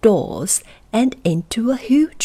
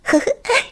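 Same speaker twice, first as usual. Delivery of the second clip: very high little goblin laugh; goblin giggle. Acted. Goblin giggles before refusing.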